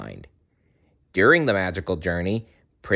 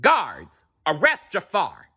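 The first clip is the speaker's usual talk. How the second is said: commanding order; 6 semitones higher than usual. A narrator's reading. Sultan orders guards.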